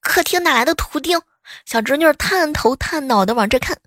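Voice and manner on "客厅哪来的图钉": mock crying shout. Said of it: "一边哭一边喊".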